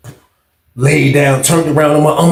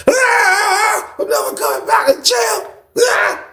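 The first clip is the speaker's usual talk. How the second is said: very high, hammed-up crying wail; mock wailing; slower than usual. Inmate enduring noise and cold, mock wailing. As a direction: wail it like a comic breakdown. Acting his own despair.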